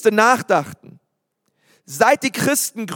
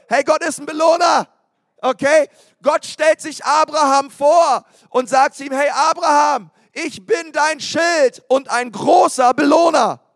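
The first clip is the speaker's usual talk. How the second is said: preaching at full force; 4 semitones higher than usual. Acted. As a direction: proclaim it to the whole hall. Preacher shouting to congregation. (Predigt mit voller Kraft)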